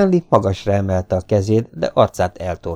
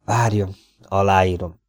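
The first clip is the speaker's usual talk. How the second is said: usual speaking voice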